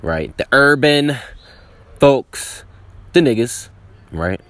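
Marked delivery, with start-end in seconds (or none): 0.4-3.7 louder, higher punch on the list; loud emphasis. Real, not acted. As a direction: punch out the last groups. Listing groups, punchline emphasis.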